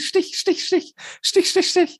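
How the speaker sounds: squeaky high-pitched „Stich“ jabs; high stabbing sound. Pretend. Venting friend miming little stabs of annoyance, squeaky high jabs. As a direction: jab out each „Stich“ in a piercing high voice like tiny needles. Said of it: "immer so links Stich".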